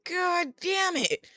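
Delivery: very high, slow drawn-out despair; exasperated groan. Retold or imitated. Spotting the petitioner, slow high exasperation. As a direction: stretch it out in mock agony. Retold dread on seeing him.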